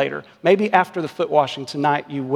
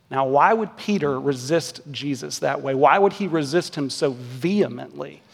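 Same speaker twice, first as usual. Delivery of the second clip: normal talking voice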